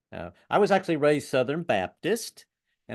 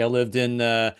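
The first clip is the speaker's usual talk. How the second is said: ordinary delivery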